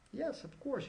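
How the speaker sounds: teacher stating the obvious with a high lilt; of course, obviously. Real, not acted. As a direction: toss it off as a no-brainer, way up high. Teacher gives the obvious answer.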